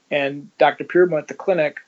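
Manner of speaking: ordinary delivery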